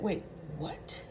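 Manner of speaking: breathless whispered wait, what; Carly whispering in disbelief; whispered shock. Retold or imitated. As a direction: gasp it out in a stunned whisper. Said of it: "Carly said".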